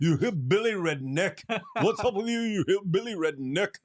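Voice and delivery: high, mocking Egyptian street intonation; hammed-up Egyptian accent. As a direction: lift the pitch like a mock Egyptian heckler. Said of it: "who pronounced it like that?".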